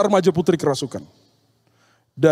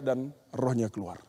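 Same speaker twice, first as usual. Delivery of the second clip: usual speaking voice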